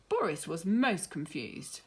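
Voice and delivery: usual speaking voice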